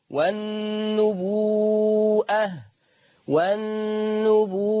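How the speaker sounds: والنبوءة slowly, waw stretched long before the hamza; Warsh reading with hamza. Shown as a demonstration. Teacher demonstrates the recitation slowly for the class. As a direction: draw out والنبوءة with a clear hamza. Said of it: "فتقرأ".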